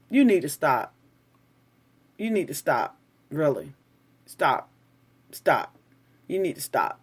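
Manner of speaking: low, soft, firm "stop"; quiet stern warning. Real, not acted. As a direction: warn them under your breath. Telling a commenter to stop.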